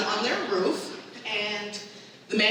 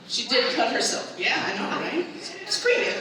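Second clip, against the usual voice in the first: ordinary talk, nothing marked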